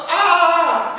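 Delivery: loud, high yelp of pain; comic waxing shriek; re-enacted pained scream. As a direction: scream as the wax strip comes off. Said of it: "I started screaming like a baby".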